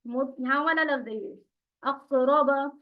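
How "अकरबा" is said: अकरबा with a thick, full back qaf; heavy Arabic qaf in अकरबा; heavier qaf. Shown as a demonstration. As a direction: read अकरबा a touch heavier. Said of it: "और थोड़ा मोटा पढ़िए".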